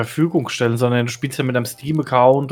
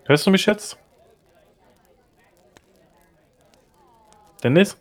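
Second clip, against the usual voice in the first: raised, high calling voice; 6 semitones higher than usual. Real, not acted. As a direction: call out over a bad line. Calling over broken connection.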